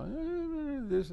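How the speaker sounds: high squeaky questioning eh, lightly mocked; doubtful eh. While listening together with Chris, a high mock doubtful eh. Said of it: "So we'll listen together and I'll".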